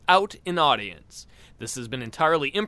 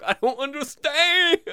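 much higher, slowed, dumb bewildered cry; confused high yell; 11 semitones higher, 3 dB louder than usual. Retold or imitated. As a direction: wail it like you're lost. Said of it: "yelling that".

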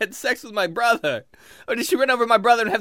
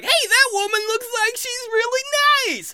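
very high, naive elf voice; friendly drunk elf. Acted. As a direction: admire someone in a squeaky little voice. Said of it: "Friendly is like".

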